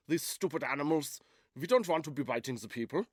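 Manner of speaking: mock German shepherd accent. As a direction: complain in a comic German accent. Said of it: "I wonder if they're German".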